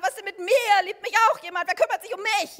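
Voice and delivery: a touch of a loud, needy whine; the inner alarm demanding attention, mildly hammed; needy ego voice. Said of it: "der Ich-Alarm". (leicht gespielte, jammernde Ich-Stimme)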